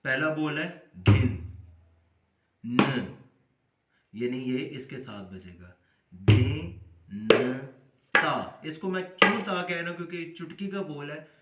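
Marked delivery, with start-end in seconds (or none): 1.0-3.1 loud, isolated drum syllables on the beat; slow syllable-by-syllable. Shown as a demonstration. Teacher breaking the rhythm down for learners, slow and separated. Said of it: "लफ्ज़ ब लफ्ज़ बताता हूँ". 6.1-8.6 loud, isolated drum syllables on the beat; slow syllable-by-syllable. Shown as a demonstration. Teacher breaking the rhythm down for learners, slow and separated. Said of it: "लफ्ज़ ब लफ्ज़ बताता हूँ".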